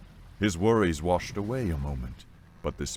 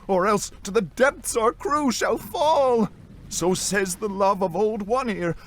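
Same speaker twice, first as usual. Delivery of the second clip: put-on high, urgent young deckhand; desperate, high-pitched warning to a shipmate; frightened deckhand pleading; 5 dB louder than usual.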